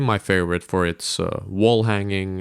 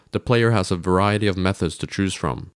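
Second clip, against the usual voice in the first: usual speaking voice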